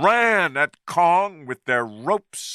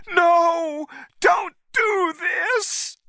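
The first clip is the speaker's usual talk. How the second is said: much higher, slow desperate shout; crying out; much slower than usual. A narrator's reading. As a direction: scream it to stop them. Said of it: "Anne cried".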